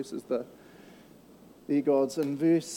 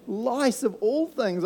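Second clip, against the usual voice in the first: drawn out, higher in pitch; slow disgust; 8 semitones higher, much slower than usual. Acted. Preacher grossed out by lice, slow and high. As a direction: stretch it out, grossed out.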